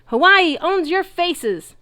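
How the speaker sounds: very high loud trash-talk shout; high, slow, gloating yell. A narrator's reading. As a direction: taunt the enemy at full volume. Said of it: "a third one shouted".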